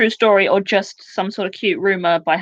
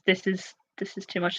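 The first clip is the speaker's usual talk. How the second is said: usual speaking voice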